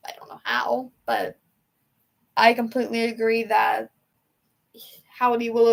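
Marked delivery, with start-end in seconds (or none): 0.1-1.4 whisper. Real, not acted. Musing on the stable's future, whispered. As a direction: drop to an unvoiced whisper. Trailing thought, second time whispered.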